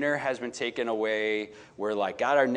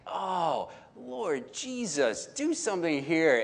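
higher, slow pleading to the Lord; a mock anguished plea, higher and slowed; desperate prayer; 5 semitones higher, 3 dB quieter, slower than usual. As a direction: beg heaven for help, drawn out. Desperate prayer under bright screen.

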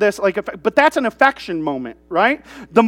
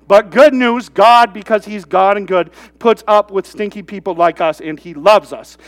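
normal talking voice

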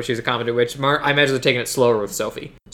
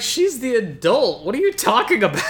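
exasperated retort; 6 semitones higher than usual. Retold or imitated. Own exasperated retort to character.

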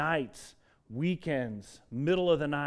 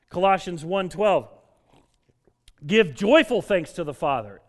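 loud, high, fervent preaching; full-force preaching; 6 semitones higher, 11 dB louder than usual. Acted. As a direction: preach it out to the whole room with fire.

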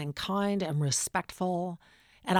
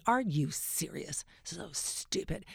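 scornful put-down; 10 dB quieter, slower than usual. Retold or imitated. Author belittles interviewer.

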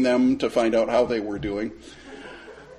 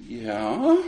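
hesitant, questioning rise; imitated uncertainty; 4 semitones higher than usual. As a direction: act out their doubtful assent, drawn out. Witnesses unsure after his explanation.

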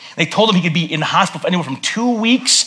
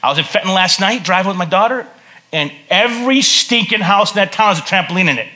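ordinary talk

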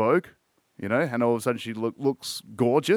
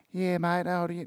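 bad mock Cockney chat, pitched higher; a clumsy higher stab at a London accent; 6 semitones higher, 4 dB quieter than usual. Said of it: "that was a terrible impression".

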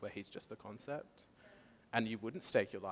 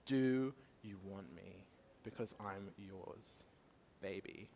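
slow and much softer, intimate; tender God voice; 3 semitones lower, 7 dB quieter, slower than usual. Retold or imitated. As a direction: murmur it like a loving invitation. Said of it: "that's what he's saying to each one of you".

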